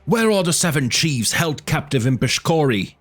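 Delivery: angry demand. A narrator's reading. Angry tribesmen demand answers.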